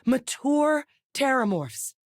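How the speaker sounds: high, slow, weighted disclosure; alarmed revelation. Acted. Researcher explaining what speeds up Terramorph growth, alarmed. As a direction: spell out the discovery slowly in disbelief.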